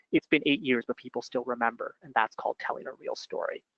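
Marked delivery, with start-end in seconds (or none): none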